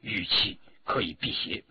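hushed, low-pitched aside. A narrator's reading. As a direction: say it softly and low, keeping it secret. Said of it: "低声地说". (压低声音说)

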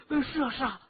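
excited, admiring rush of assent; hasty agreement. A narrator's reading. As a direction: jump in quickly to agree, impressed. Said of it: "孙老三忙说".

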